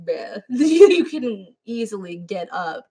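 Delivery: exasperated at the man on screen, laughing and slow; exasperated bruh. Retold or imitated. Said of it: "and I'm like".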